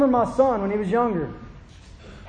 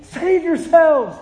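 higher, louder call to a son; pleading call; 6 semitones higher, 4 dB louder, slower than usual. Retold or imitated. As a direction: plead with your son to come. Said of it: "don't you want it to be".

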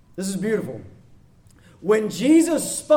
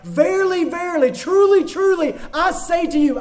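solemn Jesus proclamation; 8 semitones higher, 3 dB louder than usual. Acted. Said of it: "he would say things like".

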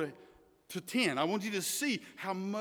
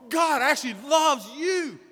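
no no no, then a loud, higher cry; emphatic shout; 6 semitones higher, 6 dB louder than usual. Real, not acted. Preacher correcting complacent listeners, rising to a loud shout. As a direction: hammer home that God actually loves you. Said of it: "emphasize those three words the way they should be emphasized".

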